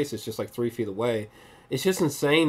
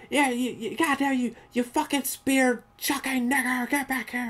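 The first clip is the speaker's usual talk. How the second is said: high, strained mock tirade; imitated angry yell; 11 semitones higher than usual. Undercover cop yelling at Ron while chasing him, imitated rage. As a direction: act out the undercover tirade in a raised pitch. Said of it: "Adam Driver literally yells".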